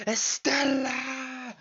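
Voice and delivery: high, long-drawn cry of a name; imitated yell. Quoting a favourite film scene, drawn-out imitated yell. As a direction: holler the name, stretching the last vowel high.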